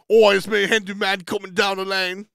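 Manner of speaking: high, loud put-on announcing voice. Host voicing his fellow caster arriving, loud and hammy. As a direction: burst in loudly as a comic British character.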